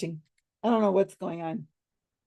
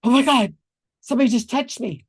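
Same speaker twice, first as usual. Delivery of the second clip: startled fright; 5 semitones higher, 5 dB louder than usual. Retold or imitated. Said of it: "And they're like".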